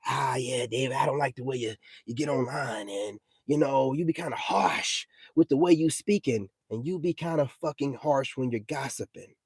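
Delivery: a hint of a pious lecturer talking down; mock churchgoer scolding. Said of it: "These are the ones that'll tell me".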